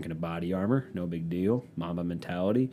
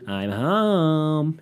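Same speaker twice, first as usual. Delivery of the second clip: high, loud sing-song greeting; girlfriend arriving home; 8 semitones higher, 4 dB louder than usual. Retold or imitated. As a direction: call out cheerfully coming through the door. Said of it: "She's gonna come in the house like".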